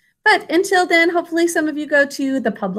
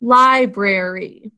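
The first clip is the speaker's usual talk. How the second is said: slow sounded-out word; 2 semitones lower, slower than usual. Shown as a demonstration. Teacher sounding out a word for her pupils, slow and clear. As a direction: say "Library" slowly, every syllable clear. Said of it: "Can you sound that out with me?".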